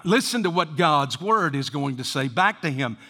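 ordinary talk

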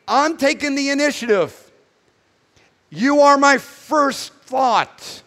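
lifted, earnest voice of God; voicing God. Preacher voicing God, tender and lifted. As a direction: speak as God reaching out first. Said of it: "God saying".

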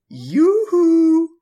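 high, loud, sing-song cartoon call; cartoon yoo-hoo. Tai pokes a hand through the wall, cartoonish high loud call. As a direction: call it out like a Looney Tunes character. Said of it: "sticks his hand out of the wall and goes".

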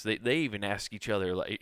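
ordinary talk

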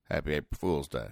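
usual speaking voice

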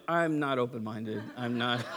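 low, soft, laughing denial; laughing refusal. Retold or imitated. Young clerk refusing the phone caller, laughing low and soft. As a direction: chuckle it out low.

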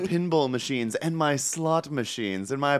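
put-on posh accent, falling away at the end; hammed-up posh accent; mock posh accent. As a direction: play a posh rich man's accent. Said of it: "He doesn't have that accent".

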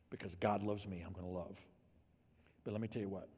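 normal talking voice

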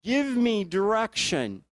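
a hint of Gideon on his knees begging; pleading Gideon. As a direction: ask God for help in confusion. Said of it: "He goes".

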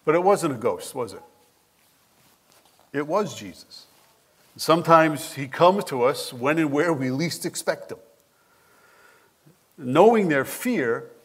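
usual speaking voice

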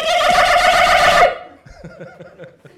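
long high piercing bird screech; plover screech. Retold or imitated. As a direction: shriek like an angry plover. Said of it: "it sounds like this".

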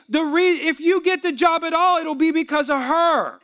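high, loud, emphatic scolding; forceful rebuke. Retold or imitated. Pastor rebuking a husband about his wife, loud forceful scolding. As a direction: lay down the truth at full volume.

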